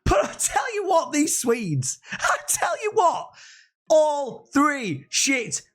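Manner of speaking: yelling in triumph, pitch way up; excited shout. Real, not acted. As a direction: crow it over the whole league. Gloating about Swedish clean sheets.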